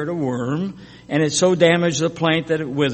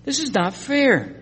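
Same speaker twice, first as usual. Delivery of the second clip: higher, sulking protest; whiny complaint; 7 semitones higher than usual. Acted. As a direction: grumble it like a sulking child. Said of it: "to put it in other words".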